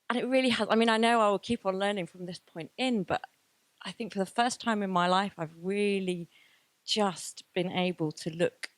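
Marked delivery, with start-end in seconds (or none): none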